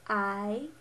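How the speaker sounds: "I" rising like a question; second tone. Shown as a demonstration. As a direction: say "I" with the pitch sweeping up, as if asking. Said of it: "here are the four tones".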